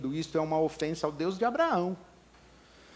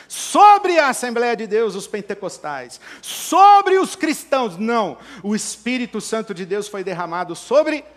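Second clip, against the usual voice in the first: raised voice surging on the key words; full-force preaching; 7 semitones higher, 13 dB louder than usual. Acted. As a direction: hammer the repeated phrases like a fired-up preacher.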